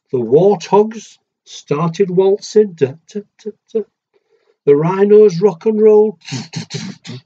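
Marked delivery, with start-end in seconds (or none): none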